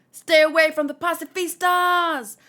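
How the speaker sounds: warning shout. Acted. Character warns others in manga.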